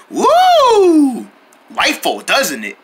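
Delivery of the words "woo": high, loud whoop; excited whoop. Acted. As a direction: cheer as it lights up. Pumpkin lights up.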